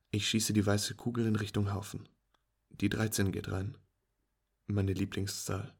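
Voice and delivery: usual speaking voice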